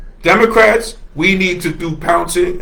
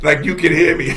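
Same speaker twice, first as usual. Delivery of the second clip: ordinary talk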